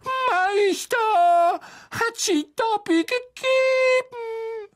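squeaky, very high and slow; drawn-out loud elf wonder; high-pitched creature in astonishment; amazed house-elf. A narrator's reading. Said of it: "sagte der Elf verwundert".

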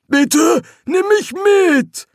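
high, loud begging; drawn-out, high-pitched entreaty; desperate plea. Acted. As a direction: plead to be taken along. Trapped voice begs for rescue.

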